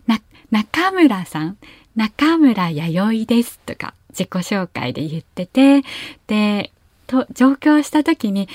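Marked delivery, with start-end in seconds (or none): 0.5-3.6 slow 中村 with the pitch rising on か; rising Kyushu intonation. Shown as a demonstration. Introducing herself as a child, slow rising pitch on 中村. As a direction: draw out 中村弥生 with a lift in the middle. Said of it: "上がるイントネーションでよく呼ばれる".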